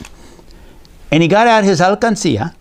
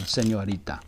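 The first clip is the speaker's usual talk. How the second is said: ordinary delivery, nothing marked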